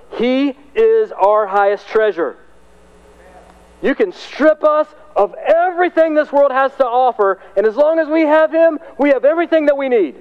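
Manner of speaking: high, full-voiced declaration; preaching climax. Real, not acted. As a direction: preach it as a rallying cry.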